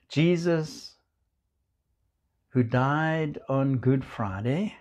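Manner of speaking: usual speaking voice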